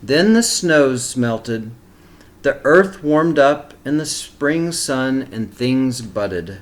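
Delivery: put-on reading a picture book aloud to children; steady, expressive storybook reading; story narration. A narrator's reading.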